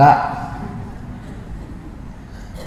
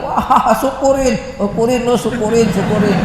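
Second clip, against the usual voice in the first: put-on gloating kids; 8 semitones higher than usual. Kids gloating at their beaten friend, laughing high. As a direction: jeer it with a laugh.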